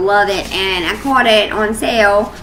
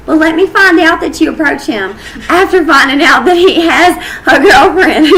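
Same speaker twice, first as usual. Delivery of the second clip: laughing; 6 semitones higher, 6 dB louder than usual. Acted. Jokingly warning off other women.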